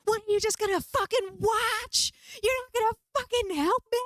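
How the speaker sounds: very high, loud furious yelling; screaming at aliens. Retold or imitated. Yelling at friends who look like aliens, high furious screams. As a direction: scream at them for not helping. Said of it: "So I start screaming at these aliens".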